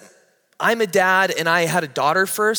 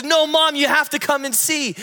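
urgent little sister; 10 semitones higher than usual. Retold or imitated. Sister urges mom to come and see, high and urgent. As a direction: pull mom along, high and insistent.

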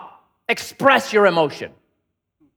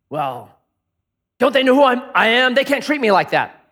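higher, louder, offended and self-important; indignant self-talk; 7 semitones higher, 5 dB louder than usual. Acted. Preacher acting out a proud angry thought, indignant. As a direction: play the wounded ego protesting.

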